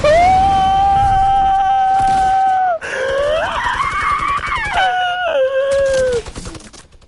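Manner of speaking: high burst of screaming laughter; loud shrieking laugh. Real, not acted. She cracks herself up mid-rant, loud shrieking laugh. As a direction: cackle loud and long. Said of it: "That was funny".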